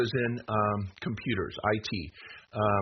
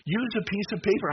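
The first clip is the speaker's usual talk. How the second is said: urgent raised warning; 8 semitones higher than usual. Real, not acted. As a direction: raise your voice to warn them. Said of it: "sorry, I'm raising my voice".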